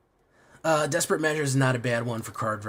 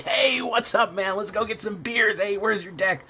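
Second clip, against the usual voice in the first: outgoing party guy; 8 semitones higher than usual. Retold or imitated. Mocking the convention-goer he is not, hyped party voice. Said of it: "I wouldn't be like".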